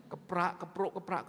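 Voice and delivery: rhythmic spoken keprak keprok beat; ketoprak drum sounds. Retold or imitated. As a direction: tap out the ketoprak beat with your voice. Imitates ketoprak percussion rhythm. (menirukan bunyi kendang ketoprak)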